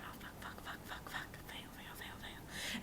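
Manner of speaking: frantic whispered swearing; panicked whisper. Retold or imitated. Panicked inner thought.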